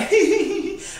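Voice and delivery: high-pitched real giggle; giggling. Real, not acted. Woman tickled by her own racy story, giggling. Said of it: "I'm just giggling".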